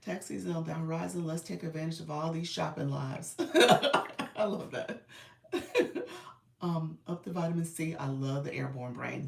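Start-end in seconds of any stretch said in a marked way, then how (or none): 3.4-4.3 high, loud laugh; a real laugh breaking out; loud burst of laughter. Real, not acted. Reacting to a viewer's comment, loud real laugh. 5.5-6.3 breathy squeak of a laugh; a quick giggle; high airy giggle. Real, not acted. Reacting to chat, high airy giggle.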